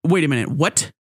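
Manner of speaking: usual speaking voice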